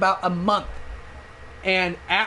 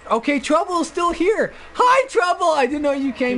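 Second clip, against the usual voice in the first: excited greeting; 9 semitones higher, 3 dB louder than usual. Real, not acted.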